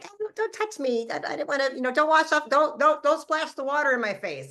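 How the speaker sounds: high-pitched frantic plea, pushing him away; panicky, begging him not to touch; frantic pleading. Retold or imitated. Woman pleading with her boyfriend not to touch her face, high frantic plea.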